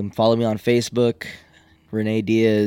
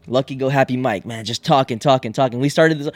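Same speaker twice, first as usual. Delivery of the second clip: usual speaking voice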